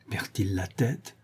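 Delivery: slow, soft, aghast murmur; frightened murmur. A narrator's reading. Fedora appalled by Ivan's incomprehensible answers, frightened murmur. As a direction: murmur it slowly to yourself, appalled. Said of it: "murmura Fedora, vraiment épouvantée".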